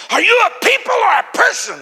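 high, very loud screamed accusation; screaming. Retold or imitated. Angry stranger screaming at a preacher in Starbucks, screaming. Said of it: "started screaming at me".

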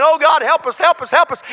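high, loud pleading cry to God; Joshua pleading. Acted. Joshua on his knees before God, a loud high plea. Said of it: "started screaming at God".

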